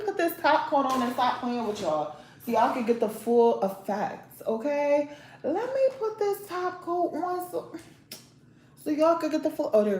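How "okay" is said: ordinary talk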